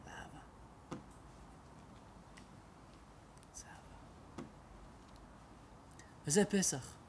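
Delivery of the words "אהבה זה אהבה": soft whispered ending; whispered reverent close. Acted. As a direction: whisper the word love. Whispered conclusion on love.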